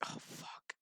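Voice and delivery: stunned whispered curse. Retold or imitated. Riker whispering as his captain dies. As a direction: breathe it out, no voice. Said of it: "and be like".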